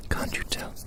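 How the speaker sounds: usual speaking voice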